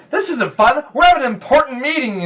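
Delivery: put-on high, loud, quick kid protest; hurried boyish objection; indignant boy. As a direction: insist on it like a self-important child. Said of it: "says the boy with the".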